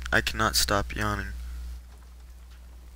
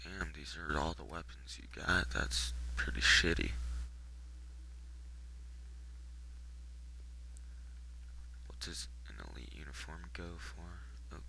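hushed whisper aside; 5 semitones lower, 15 dB quieter than usual. Real, not acted. Gamer muttering about the shop's weapons, whispering. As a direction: whisper it so the shopkeeper won't hear. Whispering while browsing shop.

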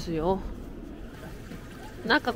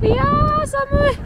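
high shivering cry of cold; freezing cold cry; 4 dB louder, slower than usual. Real, not acted. Vlogger squeals at the biting wind, high and loud.

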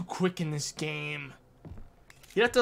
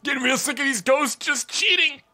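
sore loser; 8 semitones higher, 7 dB louder than usual. Real, not acted. Still fuming about ghosts.

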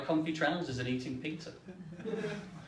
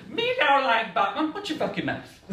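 put-on grumpy toddler; 9 semitones higher, 4 dB louder than usual. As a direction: drag it out like a stubborn toddler. George refusing again.

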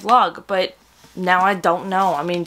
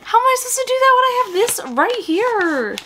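mock wail; 14 semitones higher than usual. Reader protesting that this book distracts from tonight's readathon, mock wail. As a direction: wail it in a squeaky high voice. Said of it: "and I'm like".

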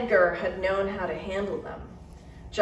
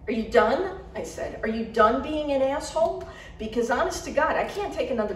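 higher, louder furious demand; angry; 6 semitones higher, 4 dB louder than usual. A narrator's reading. Narrator confronting Garrett, angry. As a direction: confront him hard.

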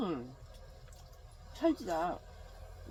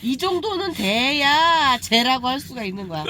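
ordinary talk

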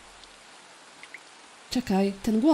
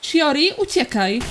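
shouting a warning to flee; 5 semitones higher, 4 dB louder than usual. Acted. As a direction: yell her name loud and high, then the order. Miko warns Shiori of danger. (krzyk ostrzegawczy)

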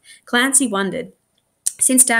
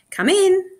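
eager call; 11 semitones higher, 4 dB louder than usual. A narrator's reading. Child answers door eagerly.

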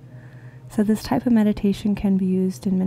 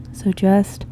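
guided meditation; 7 semitones lower than usual. Real, not acted. Meditation leader inviting listeners into the heart space, calm and unhurried. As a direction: ease them gently into this moment, leaving space between phrases.

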